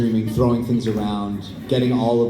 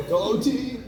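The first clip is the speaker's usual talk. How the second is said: sports fan yell; 13 semitones higher than usual. Shown as a demonstration. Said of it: "pretend that you're at, like, a sports game".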